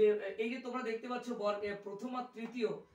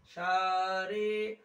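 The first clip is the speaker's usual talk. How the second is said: "সা" and "রে" each held long on a steady note; drawn-out sargam notes; 3 semitones lower, 5 dB louder, much slower than usual. Shown as a demonstration. Teacher showing song notation, "সা" and "রে" drawn out slowly. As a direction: stretch "সা", pause, then stretch "রে". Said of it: "গানের স্বরলিপি".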